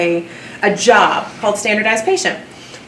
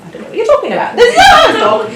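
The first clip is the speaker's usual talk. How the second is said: hammed-up childish British accent, much higher and louder, laughing through it; a kid's over-the-top British accent, giggling; mock British accent, pitched way up and breaking into laughs; fake British accent; 6 dB louder, faster than usual. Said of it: "I had the best British accent".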